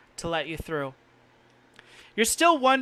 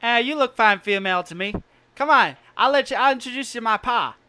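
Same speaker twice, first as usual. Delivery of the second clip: lightly voiced son, rising louder and higher; eager young helper; 5 semitones higher, 6 dB louder, faster than usual. Son offering to introduce the stranger to pa, encouragingly. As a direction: urge the stranger along.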